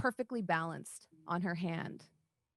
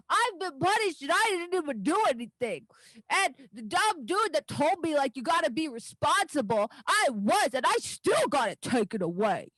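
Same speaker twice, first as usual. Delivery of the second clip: put-on high and louder, a wronged little girl; indignant child; 7 semitones higher, 6 dB louder than usual. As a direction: pout it out like a punished kid. Daisy angry at her punishment.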